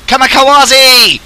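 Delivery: high, drawn-out kamikaze yell; battle cry. Pretend. Gamer charging into the enemy, playful battle cry. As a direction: call it out like a charging pilot.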